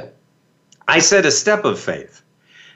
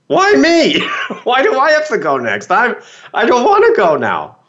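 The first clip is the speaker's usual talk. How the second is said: mock scared protest; 7 semitones higher than usual. Asked to go next on zipline.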